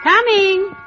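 high, loud call to the door. Acted. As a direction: call out across the house. Answers door, calling out.

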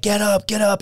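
retold urgent call, pitched high; urgent wake-up call. Friend pounds on a door to wake his buddy, retold urgent call.

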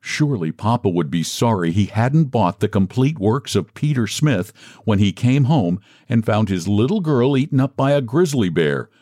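ordinary delivery, nothing marked

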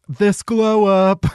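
slow, drawn-out mock gush; mocking caption voice. Mocking fans' photo captions.

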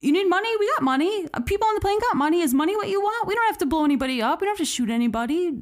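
frantic attendant bargaining with the hijacker; frantic offer. Retold or imitated. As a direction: talk fast to talk a man out of a bomb. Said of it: "and says, like".

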